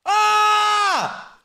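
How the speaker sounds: high, loud angry scream; sudden gamer rage yell; raging scream. Real, not acted. Killed in game.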